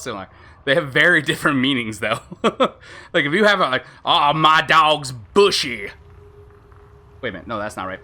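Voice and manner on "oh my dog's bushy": slow, drawn-out botched Australian accent; stretched mock-Australian drawl; attempted Australian accent. Shown as a demonstration. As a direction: have a first, off-target go at Australian. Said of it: "I wanna make sure bushy sounds Australian".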